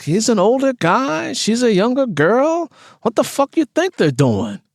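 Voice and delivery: put-on high, brash and crude girlfriend voice; sassy friend Frida.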